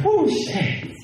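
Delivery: a sudden high yelp of surprise, then soft; startled oh shit. Retold or imitated. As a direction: caught off guard, voice jumps up. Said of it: "I was like".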